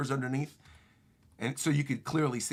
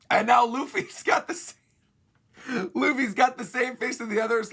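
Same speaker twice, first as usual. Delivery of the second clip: high voice breaking with laughter; real laughter squeezing the words; cracking up; 8 semitones higher, 4 dB louder than usual. Real, not acted. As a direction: narrate through helpless laughing. Cracks up describing the face.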